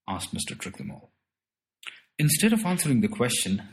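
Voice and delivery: ordinary delivery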